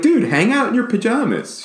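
high-pitched, exaggerated coaxing; mock parental plea. Parent urging daughter to relax, mock pleading.

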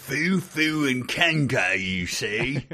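slow, high mock-British voice; British accent. Co-host joins in mocking the food complaint, British accent. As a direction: stretch the words in silly British. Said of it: "he did it in a British accent".

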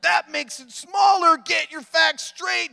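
high, loud angry yell; angry shout. Retold or imitated. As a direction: scream it at the TV show. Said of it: "guessing what his voice sounded like".

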